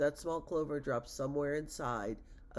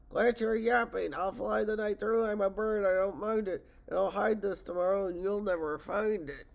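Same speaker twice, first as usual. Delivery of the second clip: loud, raised taunting call; calling back; 4 semitones higher, 5 dB louder than usual. A narrator's reading. Eagle flying off answers the chasing elephant, calling back. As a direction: throw it back over your shoulder. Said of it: "over his shoulder called back".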